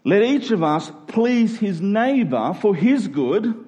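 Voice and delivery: ordinary talk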